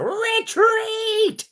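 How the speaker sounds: very high, loud villain screech; Cobra Commander. Acted. Actor imitating a cartoon commander ordering troops, high screeching shout. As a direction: shriek the command, drawn out and piercing. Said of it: "Chris Latta, you know, s-, uh, C-, Cobra Commander".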